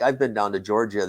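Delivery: ordinary delivery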